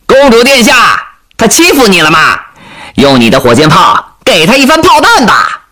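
high-pitched, rowdy teasing call; joking heckle. Acted. Soldiers teasing the princess about her companion, joking high shouts. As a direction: holler it playfully across the group. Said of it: "一些人开始热情地开玩笑".

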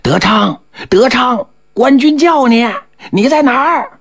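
villagers calling for a missing man; very high, stretched calls without real volume; hollering. A narrator's reading. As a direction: holler the name across the village. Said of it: "就听吆喝".

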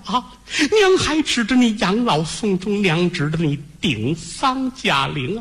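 high, slow lament, drawn out; grieving mother. Acted. Mother laments to her son who will die, high slow keening. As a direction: plead and mourn in one breath. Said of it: "眼睛都哭肿了".

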